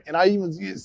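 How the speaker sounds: ordinary delivery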